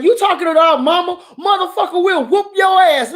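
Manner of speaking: put-on very high, loud furious kid threat; defending mama. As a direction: go after whoever insulted mama. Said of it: "me and my sister like".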